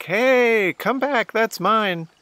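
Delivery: high-pitched mock yell after a thief; retold yelling. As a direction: re-enact calling after someone running off. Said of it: "I was yelling".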